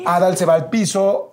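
normal talking voice

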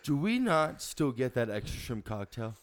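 entitled Karen berating the waiter; Karen customer. Acted. Customer to waiter: entitled Karen rant. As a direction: demand the manager, cutting him off. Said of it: "we're gonna learn how to Karen".

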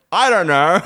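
high, loud, exasperated cluelessness; mock bafflement. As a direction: throw it out as a baffled, comic protest. Retold baffled answer to friends.

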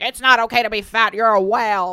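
loud jeering critic voice; loud mocking critic. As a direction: shout it like a troll. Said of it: "people are gonna come at me being like".

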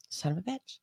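ordinary talk

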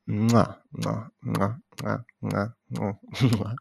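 soft string of mwah kisses; quick "mwah" smooches; kissing sounds. Pretend. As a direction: plant little pretend kisses, one after another. Plants kisses on the rescued baby.

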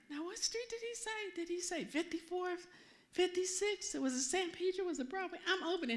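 high, half-to-herself puzzled questioning; acted puzzlement. Driver searching for the church's street, puzzled and high-pitched. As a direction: replay puzzling over a forgotten street.

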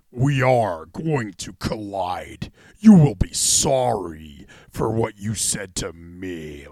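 slow, low, fake enunciation; mock a wrestler's overdone deep promo voice; very slow, put-on menace down low; forced deep voice. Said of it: "The literal enunciation and the literal way he talks is, like, fake".

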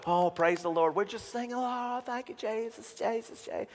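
high, soft, airy-headed worship imitation; mock praising churchgoer. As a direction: gush praise softly in a mocking high voice. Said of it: "you only get with people that".

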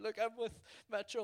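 high, urgent plea; anxious pleading. Retold or imitated. Showing the gunman his T-shirt, anxious high pleading. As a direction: plead your case in a high voice.

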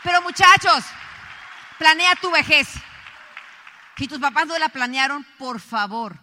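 regaño fuerte y agudo al público; exhortación a gritos. Real, not acted. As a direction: exhorta a voz en cuello. Exhorta al público con indignación. (reclamo enérgico, muy alto)

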